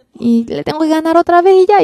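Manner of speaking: high, loud put-on wife voice; mock stubborn wife. Pastor mimicking a wife insisting she must win, high and loud. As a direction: play the wife who must win every argument.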